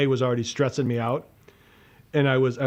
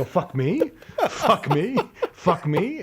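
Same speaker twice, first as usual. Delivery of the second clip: higher, questioning mock disbelief; incredulous echo; 7 semitones higher, slower than usual. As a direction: throw ME up like an outraged question. Repeats insult in rising disbelief.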